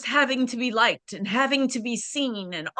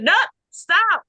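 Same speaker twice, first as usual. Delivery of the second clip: higher, louder sudden command; urgent stop; 9 semitones higher, 5 dB louder than usual. Retold or imitated. Her late mother stopping her, sharp urgent stop. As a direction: stop her hand at once. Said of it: "my mother said".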